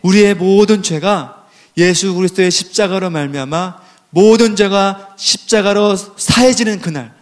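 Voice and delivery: ordinary delivery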